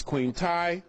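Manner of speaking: Tiye said to rhyme with "tie"; "Queen Tiye" with a long i in Tiye; pronunciation of Tiye. Shown as a demonstration. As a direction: say Tiye as one syllable, like "tie". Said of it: "some people pronounce it".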